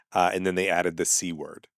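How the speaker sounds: ordinary talk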